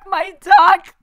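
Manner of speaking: high, loud manic scream; over-the-top yell. Pretend. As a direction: scream it out wildly. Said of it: "way over the top".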